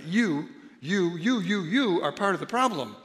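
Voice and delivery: hammering the word "you" at the listener; stress on you. Shown as a demonstration. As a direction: point the finger with every "you". Pointing at the listener.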